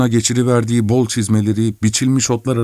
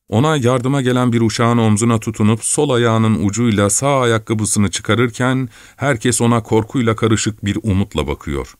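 ordinary delivery

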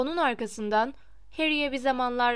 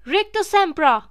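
high, loud spell yelled at a rival; shouted spell; 6 semitones higher, 9 dB louder than usual. A narrator's reading. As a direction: fling the spell out with a full yell. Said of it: "bağırdı". (yüksek perdeden bağırılan büyü)

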